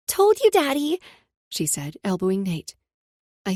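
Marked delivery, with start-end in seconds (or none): 0.1-1.0 put-on teasing little girl. Child teasing.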